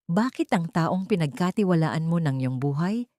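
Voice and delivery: plain reading voice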